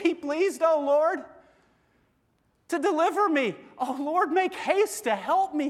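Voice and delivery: mild desperate prayer, read with urgency; desperate plea. Acted. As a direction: cry out for rescue, urgent and pleading. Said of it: "He's desperate".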